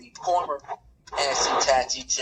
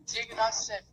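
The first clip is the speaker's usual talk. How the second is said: normal talking voice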